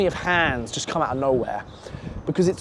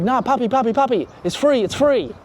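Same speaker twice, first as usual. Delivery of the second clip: higher-pitched, lightly voiced female pleading; imitated coaxing girl; 6 semitones higher than usual. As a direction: coax him in a mock girlish voice. Retold girl coaxing him.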